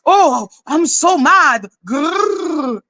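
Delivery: slow mock fury with a cartoon growl; mock meltdown. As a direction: imitate someone making a show of being mad. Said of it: "having a fucking meltdown".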